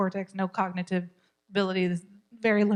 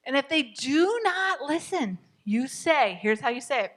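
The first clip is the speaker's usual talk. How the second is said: normal talking voice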